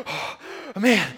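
big mock gasp for breath; acted gasping. Swimmer reaching the beach to warn the jumper, acted gasping. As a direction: gasp as if just out of the water.

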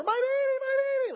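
put-on high, loud panicked mother crying my baby; frantic mama. Pastor mimics the panicked mother running over. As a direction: shriek it like a terrified mom.

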